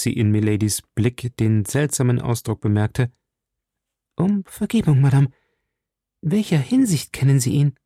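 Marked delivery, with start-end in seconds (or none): 4.2-7.8 high, politely probing voice; pointed questioning. A narrator's reading. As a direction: press politely but urgently for the truth. Misstrauische Novizin hakt nach. (höflich nachbohrend, mit hoher Stimme)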